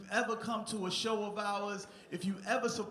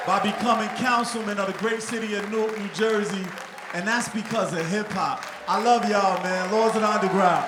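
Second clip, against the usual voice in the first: loud speech climax over applause; rousing proclamation; 10 dB louder than usual. Real, not acted. Honoree closing his thanks to hip hop fans, proclaiming loudly. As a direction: belt it out proudly to a cheering crowd.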